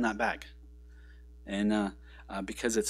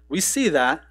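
higher, louder defiant voice of Nineveh; 5 semitones higher, 7 dB louder than usual. Pretend. Pastor voicing Nineveh rejecting God, defiant and loud. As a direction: talk back to God, brushing him off.

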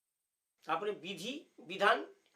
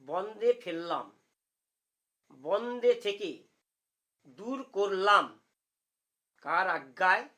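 word by word, clearly separated; staccato; 4 dB louder, much slower than usual. Shown as a demonstration. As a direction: chop each word apart so it is understood. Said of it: "কেটে কেটে বলছি যাতে বুঝতে পারেন ভালোভাবে".

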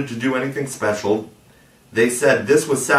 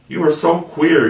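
puzzled; 7 semitones higher, 5 dB louder than usual. A narrator's reading. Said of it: "frankly puzzled".

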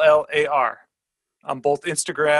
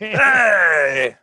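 loud, higher drawn-out cheer; shouted entrance; 4 semitones higher, 7 dB louder than usual. Pretend. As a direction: burst in with a long mock-triumphant hey. Guest makes his staged entrance.

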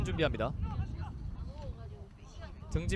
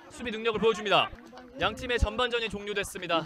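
usual speaking voice

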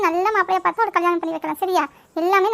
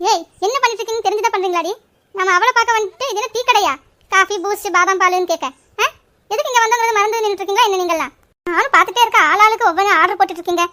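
brisk, loud telling-off that builds; angry scolding; 3 dB louder than usual. Acted. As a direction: scold them for forgetting why they came. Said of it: "இந்த அக்கா கோவப்பட்டுருச்சே". (கோபமாகத் திட்டும் குரல்)

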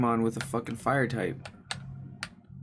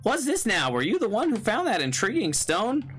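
idiot voice; 6 semitones higher than usual. Acted. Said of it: "voice him like a kind of an idiot".

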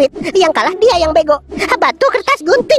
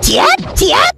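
battle cry; 13 semitones higher than usual. Acted. Sword-fight battle cry.

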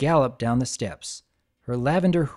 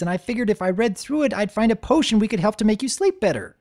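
fast, higher rushed excuse; blurting; 9 semitones higher, 3 dB louder, faster than usual. Acted. Spike rushing out his excuse to Twilight, fast. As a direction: blurt it all out in one breath. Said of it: "finally blurting".

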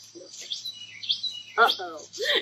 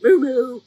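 short, loud double moo; honking moo; 6 dB louder than usual. Acted. Reader imitates cow driving the car, loud honking moos. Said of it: "What does that sound like?".

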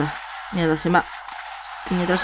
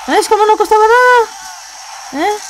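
mock sweet taunt; 11 semitones higher, slower than usual. Taunting monster in trap.

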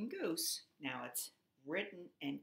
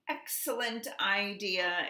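loud, pitched-up exclamation; storybook duck seizing on an idea; exclaiming; 4 semitones higher, 6 dB louder than usual. A narrator's reading. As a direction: exclaim it with big approval. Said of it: "exclaimed Duck".

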